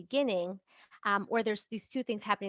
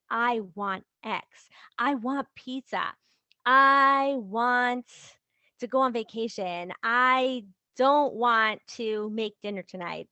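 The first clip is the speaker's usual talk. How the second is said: slow, deliberate statements with weight on "I want"; measured, emphatic delivery leaning on "I" and "want"; clear assertion; 6 dB louder, slower than usual. Shown as a demonstration. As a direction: declare each "I want" firmly, word by word. Demonstrating clear, safe self-expression.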